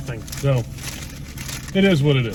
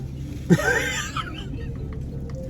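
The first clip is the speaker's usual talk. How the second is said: laugh; 6 dB quieter than usual. Real, not acted. Laugh after "glad we left.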